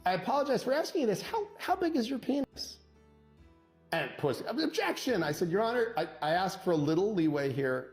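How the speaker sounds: ordinary delivery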